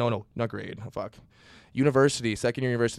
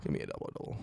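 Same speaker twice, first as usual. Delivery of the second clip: mock whispered order; 14 semitones lower, 11 dB quieter, faster than usual. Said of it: "what do you say?".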